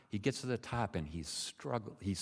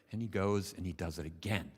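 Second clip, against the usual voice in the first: usual speaking voice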